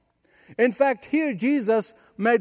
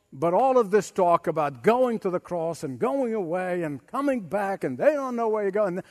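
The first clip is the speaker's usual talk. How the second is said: normal talking voice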